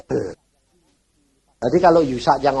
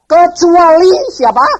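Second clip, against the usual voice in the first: 1 emphatic question; 14 dB louder, slower than usual. Real, not acted. Preacher's rhetorical challenge.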